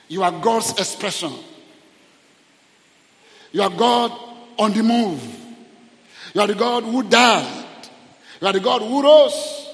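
loud, high, building proclamation; impassioned prayer. Real, not acted. Preacher praising the God who rose, impassioned prayer. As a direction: praise with rising force, phrase by phrase. Leads prayer at full force.

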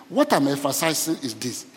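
normal talking voice

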